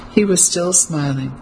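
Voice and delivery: ordinary delivery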